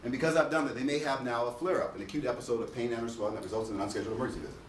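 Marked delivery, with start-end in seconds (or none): 1.9-4.6 rattled-off definition; fast recital. Shown as a demonstration. Lecturer reciting the flare-up definition again, rushed. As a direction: speed through it by rote. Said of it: "I'm gonna say this real fast".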